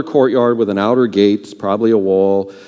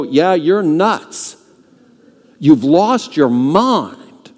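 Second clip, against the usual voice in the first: slow, dragged-out dismissive scoff; scoffing disbelief; 4 semitones higher, much slower than usual. Pretend. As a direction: brush her off like she's lost it. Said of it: "they go".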